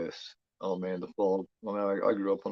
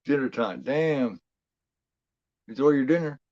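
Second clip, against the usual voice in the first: ordinary talk, nothing marked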